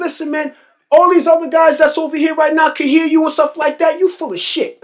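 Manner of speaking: high, insistent imitation of a Grenadian co-worker; Grenadian accent. Old-timer embarrassing a talker before the guys, Grenadian accent. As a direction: dress him down in a lightly imitated Grenadian accent. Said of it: "in his Grenadian accent".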